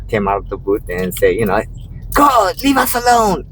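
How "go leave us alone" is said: high put-on voice telling me off; mock shooing woman. Woman from the booth shooing me off, high mock voice. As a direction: shoo him away, hammed-up.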